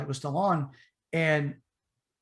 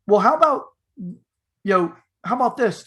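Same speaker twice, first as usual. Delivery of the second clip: pushy robber voice; 5 semitones higher, 6 dB louder than usual. Retold or imitated. Robbers pushing dad.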